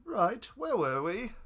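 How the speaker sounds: slow, put-on cult member voice; slow sinister cultist voice. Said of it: "That's your cultist F voice?".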